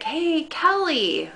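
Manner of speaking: high, drawn-out call of her name. Retold or imitated. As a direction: imitate a friend calling after someone. Friend calls her from car.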